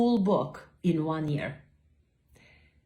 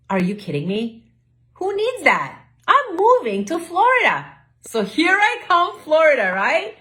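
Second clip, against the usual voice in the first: high, loud, thrilled outburst; excited; 10 semitones higher, 7 dB louder than usual. Retold or imitated. To herself about moving to Florida, thrilled and loud. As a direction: shout it like the best news ever.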